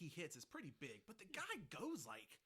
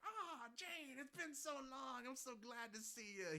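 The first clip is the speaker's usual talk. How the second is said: fond greeting; 10 semitones higher than usual. Retold or imitated. Pecos greets Jane fondly.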